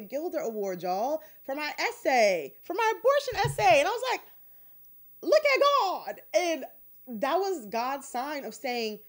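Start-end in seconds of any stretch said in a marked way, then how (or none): 5.2-6.2 high, loud cry of praise; jubilant exclamation. Retold or imitated. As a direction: exclaim it like a joyful testimony. Retold joy at winning an award.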